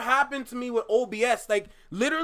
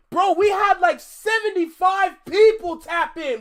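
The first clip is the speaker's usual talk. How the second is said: much higher and louder, thrilled; can't believe the viewers showed up; excited; 8 semitones higher, 8 dB louder than usual. Real, not acted. Viewer count surprises streamer.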